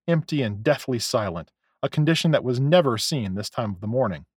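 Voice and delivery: usual speaking voice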